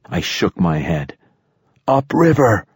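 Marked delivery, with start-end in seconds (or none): 1.9-2.7 high-pitched urgent shout. A narrator's reading. Narrator to companions in the rushing river, shouted. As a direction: shout the direction, pitch jumping up. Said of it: "I shouted".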